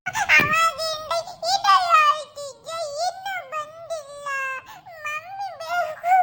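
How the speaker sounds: crying. Acted. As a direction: drag the words out through tears. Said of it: "ಎಷ್ಟ್ ಅಳೋದು". (ಅಳುತ್ತಾ ರಾಗ ಎಳೆದು)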